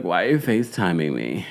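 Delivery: low mocking fry voice; vocal fry. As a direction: drag it out in lazy fry. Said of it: "Don't talk to me in vocal fry".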